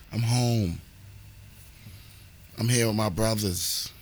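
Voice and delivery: ordinary talk, nothing marked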